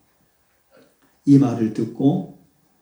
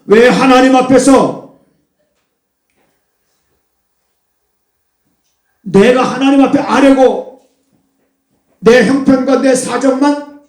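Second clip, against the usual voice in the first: anguished complaint; 8 semitones higher, 7 dB louder than usual. Acted. Believer complaining to God in prayer, loud plea. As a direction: lift the grievance up, pleading.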